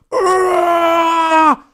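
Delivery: long high bellowing shout; imitating a woman's almighty bellow. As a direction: hold a huge piercing "Ah!". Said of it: "almighty bellow".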